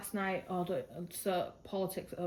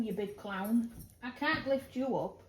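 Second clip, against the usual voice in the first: ordinary delivery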